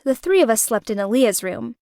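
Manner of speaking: usual speaking voice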